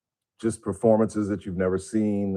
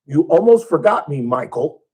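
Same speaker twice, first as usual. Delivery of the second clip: higher, louder imitation of a seven-year-old girl; Janet; 6 semitones higher, 6 dB louder than usual. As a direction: put on a cute little sister voice. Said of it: "she's about seven years old".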